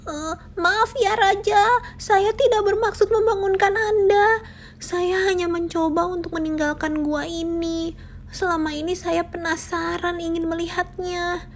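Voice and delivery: a tiny mouse begging the king for mercy; pleading mouse. A narrator's reading. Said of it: "Si tikus kecil seketika berteriak". (tikus kecil memohon ampun dengan suara tinggi)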